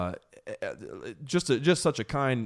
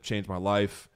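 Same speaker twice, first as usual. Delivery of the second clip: normal talking voice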